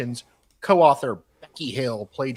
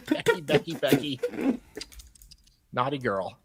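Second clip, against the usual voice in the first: teasing chide. Acted. Teasing scold of Becky.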